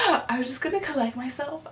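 ordinary talk, nothing marked

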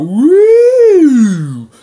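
high, very loud whooping woo; mock shrill woo. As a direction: whoop it out like the annoying instructor. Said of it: "And you always go".

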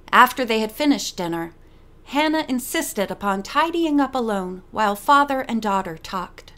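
plain reading voice

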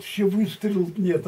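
usual speaking voice

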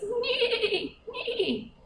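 high, laughing mock neigh; horse neigh. Teacher neighing for the horse, giggling, to the children. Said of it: "and says".

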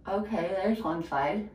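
talking while fiddling with teeth; fingers in mouth. Real, not acted. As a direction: mouth held open with fingers. Seen 2: In mirror, fingers and purple tool held inside open mouth throughout.

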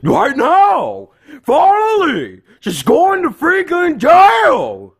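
high, drawn-out yelling; triumphant yell. Acted. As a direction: shout it with fed-up relief. Police arrive, outburst at chicken.

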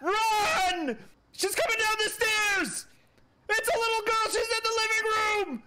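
high excited shouting; panicked warnings. Real, not acted. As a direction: yell warnings while laughing in fear. Fleeing the ghost, shouting warnings.

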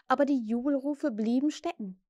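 ordinary delivery

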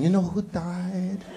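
put-on slow old woman voice; old grandmother voice. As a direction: speak slowly like a tiny grandmother.

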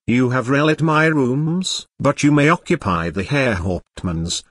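usual speaking voice

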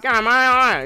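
put-on loud, high, garbled Aussie accent; full-blown Australian. Showing viewers an unintelligible Aussie, full-blown Australian accent. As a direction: slur it out in a hammed-up Australian bellow. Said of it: "full-blown Australian, where you can't get a word out".

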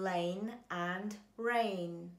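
"rain and rain", the first with an L sound and the second with a clear English R, said slowly; contrasting L and R. Shown as a demonstration. Voice teacher showing students the difference between L and R, slow careful contrast. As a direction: say "rain and rain" slowly, L then R, so the two are told apart. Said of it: "しっかり識別できる".

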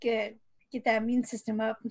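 ordinary talk, nothing marked

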